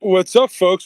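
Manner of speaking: booming show-opener greeting; loud greeting. Acted. Angry Steve greets the show loudly.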